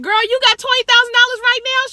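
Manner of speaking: high and loud, astonished question; shocked disbelief. Retold or imitated. Asking her friend about the check, high loud astonishment. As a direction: react like you can't believe it.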